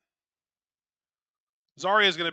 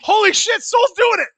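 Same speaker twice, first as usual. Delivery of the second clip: thrilled yell, much higher and louder; excited shout; 13 dB louder than usual. Real, not acted. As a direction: yell it as the winning move hits. Big match moment on screen.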